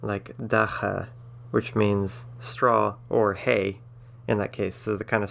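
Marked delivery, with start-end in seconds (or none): 0.4-1.1 "dacha" with a harsh throaty H in the middle; hard H. Shown as a demonstration. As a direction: say "dacha" with a rough, raspy H sound. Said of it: "which is a hard H".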